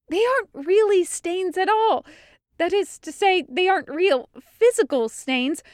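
high, fast, breathless lecture; excited explanation. A narrator's reading. Meryl explaining the stains to the crew, fast and high. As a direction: rattle off the theory in a rush.

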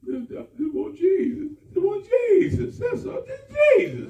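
sobbing retold plea rising to a shout; crying plea. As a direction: plead and cry out. Retelling his own crying plea.

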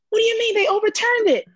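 high, loud disbelieving cry; shocked outrage. Retold or imitated. Lifter hearing her lift was overturned, loud shocked outrage. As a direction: demand it in outrage.

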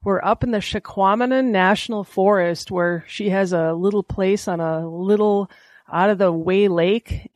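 ordinary talk

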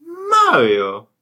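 very high, loud cartoon Italian cry; Mario voice. Answering his cohost in the Mario game, loud high Mario cry. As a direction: shout it like Mario.

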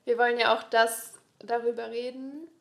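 normal talking voice

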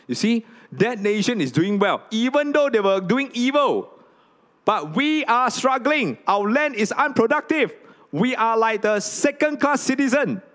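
high, loud lament of the struggling people; envious complainers. Retold or imitated. People who compare themselves to other nations, in a high, loud lament.

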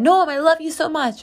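put-on high-pitched affectionate call to a little kid; sing-song aunt talking to a child; aunt doting on nephew. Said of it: "and I said".

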